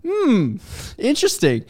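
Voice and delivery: mock thoughtful listener. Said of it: "It was a bit more of like".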